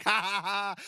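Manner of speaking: a dad mock-cackling at his daughter; teasing laugh. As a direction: rub it in with a playful laugh. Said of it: "and I'm laughing as I do".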